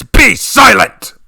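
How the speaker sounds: loud, slow furious bellow. Acted. Father bellows at his arguing daughters, loud. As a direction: roar them into silence. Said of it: "I bellowed back".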